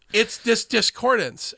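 ordinary talk, nothing marked

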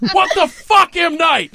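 loud, high, incredulous shout; laughing-room outburst of disbelief; exasperated yell. Real, not acted. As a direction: shout it at the director in comic outrage.

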